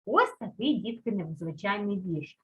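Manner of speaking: normal talking voice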